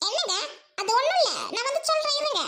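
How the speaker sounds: haughty sister scolding at her gate; snobbish rich sister. Acted. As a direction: talk down to the noisy crowd at the gate. Sister hushes her husband.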